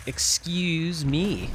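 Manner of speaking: high, very slow drawn-out cartoon hero voice; nasal-free but high mock hero plea; CD-I Link impression. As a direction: stretch it out like the cheesy CD-I Link. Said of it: "talked like the C D I Link".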